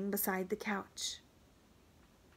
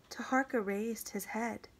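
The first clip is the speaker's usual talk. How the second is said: usual speaking voice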